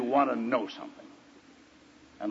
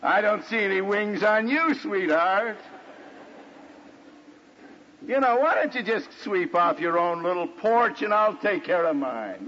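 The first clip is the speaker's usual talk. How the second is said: hammed-up defensive husband, higher-pitched; mock-sweet marital comeback; sarcastic retort; 6 semitones higher than usual. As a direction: throw the criticism straight back, mockingly. Acted defensive retort to wife.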